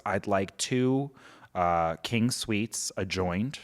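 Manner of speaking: slow, measured imitation of a hotel guest; slow, unhurried retold request; deliberate request. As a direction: order it slowly, spacing out the words. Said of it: "in the exact same way".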